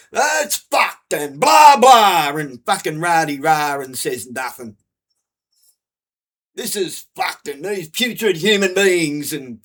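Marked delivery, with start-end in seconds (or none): 0.1-3.8 mock shouting comedian. Mocking a comedian's stage rant, loud mock yell. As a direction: mimic his ranting with a shout.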